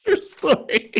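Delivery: very high, loud through laughter; real laughing exclamation. Real, not acted. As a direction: squeal it out while cracking up. Laughing after punchline.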